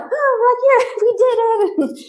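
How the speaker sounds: high relieved cheer; triumphant cheer. Retold or imitated. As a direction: celebrate making it past.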